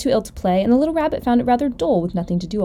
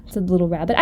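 ordinary delivery

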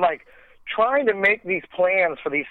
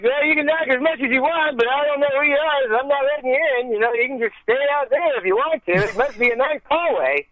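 high, fast, breezy deadpan; Norm Macdonald voice; 10 semitones higher than usual. Retold or imitated. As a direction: brush them off, totally unbothered.

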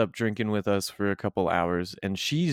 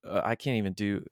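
ordinary delivery

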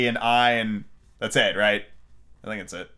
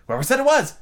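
high, loud comeback; indignant retort; 13 semitones higher, 5 dB louder than usual. A narrator's reading. Red Queen retorting to Alice, indignant. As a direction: throw it back, high and loud.

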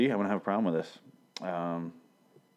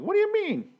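a much higher, squeaky nervous yelp; mock-nervous character whining in a high voice; nervous squeak. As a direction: squeak it out like a frightened man. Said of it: "very, very nervous".

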